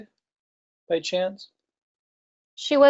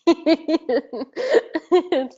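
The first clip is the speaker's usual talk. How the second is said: laughing; 6 semitones higher than usual. Real, not acted. Host reacts to chipmunk-sounding audio.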